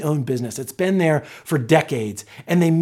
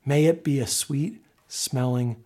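lower, softer, reverent prayer; quiet prayer; 5 semitones lower, 6 dB quieter than usual. Real, not acted. Pastor thanking God in Jesus' name, quiet prayer. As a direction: bring the prayer gently to a close. Let's pray.

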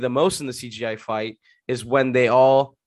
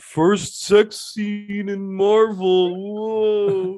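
mock awe; 7 semitones higher, 4 dB louder, much slower than usual. Mock awe at sex scene.

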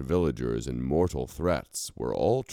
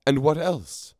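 quick, much higher prompt; added quickly; 13 semitones higher, 5 dB louder than usual. A narrator's reading. Setzig presses the Icons quickly and higher. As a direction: slip the question in quickly. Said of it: "added quickly".

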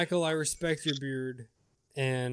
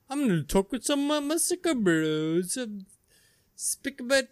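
higher, goofy bro impression; mock dumb bro voice; 7 semitones higher, 3 dB louder than usual. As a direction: play a clueless bro.

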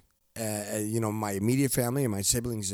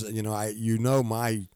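ordinary talk, nothing marked

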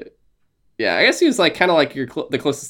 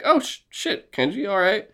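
pitched-up, pleasantly taken aback; mock surprise in a higher voice; impressed surprise; 7 semitones higher than usual. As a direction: won over despite yourself. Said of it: "And you're like".

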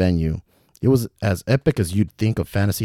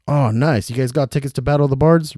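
lightly raised, eager question; excited; 6 semitones higher, 4 dB louder than usual. Acted. Ricky asking about the tickets, excited. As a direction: perk up at the news. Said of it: "Ricky asked excitedly".

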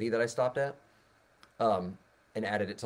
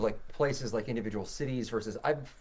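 usual speaking voice

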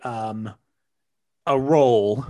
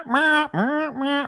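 high, nasal-free squeaky meh meh meh sounds; a silly high meh, meh, meh; mumbled meh; much slower than usual. Shown as a demonstration. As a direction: bleat the meh sounds up high like a lost word. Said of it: "Even if your words are meh".

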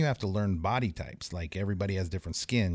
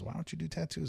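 tattoo artist; 9 semitones higher, 8 dB quieter than usual. Retold or imitated. Tattoo artist voiced in story.

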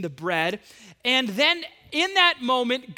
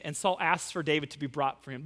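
ordinary delivery

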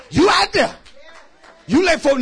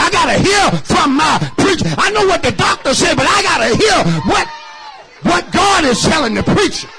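loud whooping sermon, pushing every line; full-force preaching; 4 semitones higher, 5 dB louder than usual. Real, not acted. Pastor whooping to the church about needing preachers. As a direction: preach it hard to the congregation.

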